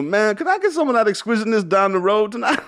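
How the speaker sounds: high, loud impression of an American comedian; Reg. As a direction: push his voice way up and plead for a favour. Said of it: "I'll do the voice".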